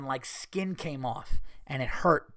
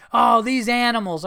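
high, loud exaggerated disgust; mock outrage; 8 semitones higher, 6 dB louder than usual. Man imagining his reaction to water park crowds, mock-outraged. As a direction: exclaim in hammed-up dismay at the crowd.

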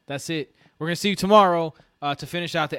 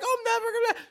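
mock whine; 4 dB quieter than usual. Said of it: "now he's like".

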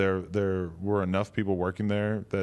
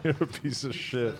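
laughing; 3 semitones higher, 5 dB quieter than usual. Real, not acted. Teasing through laughter.

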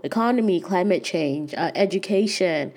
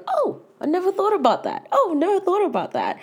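much higher, mock double-take surprise; imitating surprised experts; 12 semitones higher than usual. Specialists at lunch realizing something new, in high mock surprise. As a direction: gasp out a delighted oh, like an expert caught off guard. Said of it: "the double take moments where they're like".

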